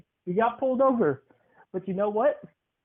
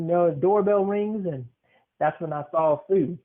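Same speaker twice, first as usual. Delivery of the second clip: normal talking voice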